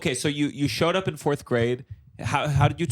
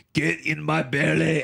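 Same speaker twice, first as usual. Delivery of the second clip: Fat Bastard quote; slower than usual. Retold or imitated. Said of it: "the country watching him go".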